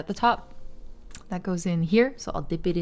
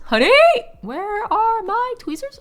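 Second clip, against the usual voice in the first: mock calling out; 6 dB louder than usual. Calling honey for lost tweezers, high mock holler.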